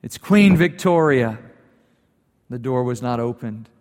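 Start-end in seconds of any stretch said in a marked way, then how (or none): 0.0-1.4 louder, clipped royal announcement; insistent demand. Retold or imitated. Victoria, still angry, announces herself to the locked door. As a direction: state your title like a command. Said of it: "She hammered on the door again".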